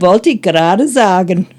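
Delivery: "Wollte ich gerade sagen" in a light East German lilt, imitating the woman; a cute East German dialect catchphrase. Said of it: "she says it in the, the East German dialect".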